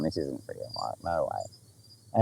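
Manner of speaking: quiet stunned inner voice; inner disbelief. Retold or imitated. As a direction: murmur it to yourself, stunned. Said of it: "in my head going".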